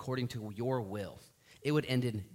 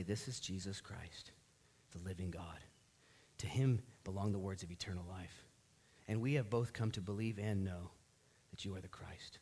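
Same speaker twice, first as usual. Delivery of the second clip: reverent confession; 6 semitones lower, 7 dB quieter than usual. Retold or imitated. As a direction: say it quietly like a sacred vow. Peter's confession voiced.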